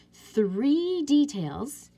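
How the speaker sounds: three details, slow, high and loud; stress. Shown as a demonstration. Teacher stressing the checklist item to students. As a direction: lean hard on three details.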